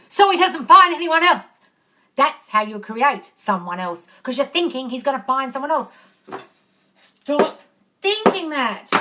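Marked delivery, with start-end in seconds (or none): 0.1-1.5 loud, higher throw-back of someone's words; emphatic mocking quote. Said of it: "that bit of the sentence where you said". 6.9-8.9 higher, emphatic command with a pause; forceful scolding. Real, not acted. Scolding viewer for negative thinking.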